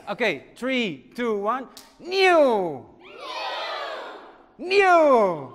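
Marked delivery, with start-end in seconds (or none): 2.0-5.5 high shouted superhero cry; superhero sound. Acted. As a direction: call it out like a hero. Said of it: "Let's all do".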